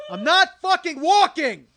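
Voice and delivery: high, loud, drawn-out yell; enraged scream. Acted. Comedian right in the woman's face, enraged scream. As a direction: snap in rage, stretching each word. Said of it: "convey to you the rage I felt".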